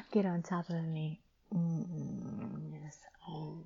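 usual speaking voice

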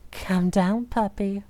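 ordinary delivery

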